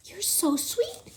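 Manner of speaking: soft breathy whisper, slow; tender whispered coo; whispered sweetly. Acted. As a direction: whisper it lovingly to a tiny mouse. Princess speaks tenderly to mouse.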